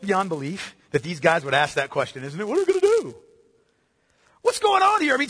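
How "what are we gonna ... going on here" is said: much higher, panicky voice of the disciples; flustered disciples. Acted. Preacher mimics the baffled disciples, high and panicky. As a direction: play the disciples at a loss, voice jumping up high.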